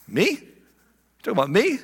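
high-pitched disbelieving question; surprised, who me. Acted. Nathanael singled out by Jesus, surprised. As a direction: ask it as if singled out unexpectedly. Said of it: "Nathanael's doing one of these".

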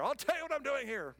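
high, loud retort; mock angry Elijah; indignant rant. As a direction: fire back indignantly. Elijah ranting back to God.

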